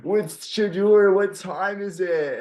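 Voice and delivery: high, slow drawn-out frat bro chant; mock a rowdy college fan, high and slow; frat boy voice. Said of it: "in his, like, little frat boy voice".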